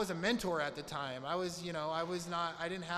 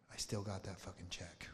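hushed disbelieving whisper, much lower; 9 semitones lower, 6 dB quieter than usual. Retold or imitated. Old racer to customer, a stunned whisper. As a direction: breathe it out in shock.

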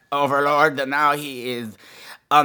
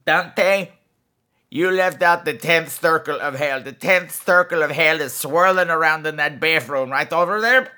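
gargoyle character voice; 3 semitones higher than usual. Acted. Podcast host voicing a gargoyle character.

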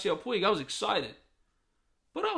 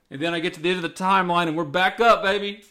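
fast, loud, building excitement; excited; 8 dB louder, faster than usual. Real, not acted. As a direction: rush it out, thrilled. Excited retelling, louder and faster.

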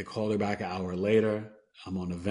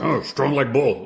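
loud laughing mock boast, slower; 4 semitones higher, 8 dB louder, slower than usual. Telling the nurse he is strong, laughing loudly.

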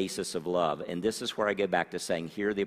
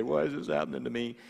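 mock whine; 3 semitones higher, faster than usual. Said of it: "whine and moan and complain".

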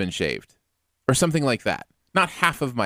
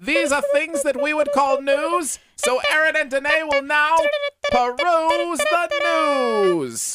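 booming, high game-show announcer; hammed-up big announcer intro; 13 semitones higher, 3 dB louder than usual. Hams up the segment announcement.

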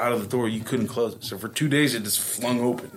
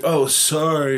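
casual apology; 5 semitones higher, 4 dB louder, slower than usual. Retold or imitated. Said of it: "She's like".

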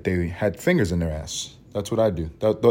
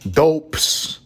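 slow, higher mock New York insult; imitated New York old-timer, drawn out; old New York accent; 8 semitones higher, 3 dB louder, much slower than usual. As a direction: stretch it out like an old-time New Yorker. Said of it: "that's how people talk back then".

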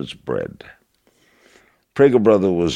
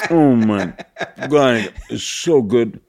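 raised, slow, laughing through the mouthful; savoring it with a full mouth; mouth full of peanut butter; 7 semitones higher, slower than usual. Retold or imitated. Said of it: "when you got peanut butter in your mouth, you go".

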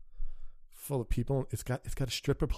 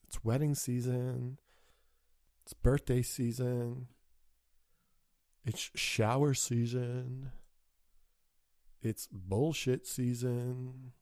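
each line slowed right down; slow deliberate list; slower than usual. Real, not acted. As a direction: spell out every season slowly. Slow listing.

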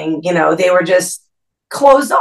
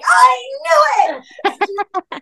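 excited; much slower than usual. Retold or imitated. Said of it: "she's like".

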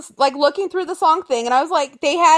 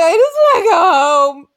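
a touch of mock sobbing in the voice; mock crying; 6 dB louder than usual. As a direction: whine it like a crying kid who wants to leave. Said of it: "And I'm like".

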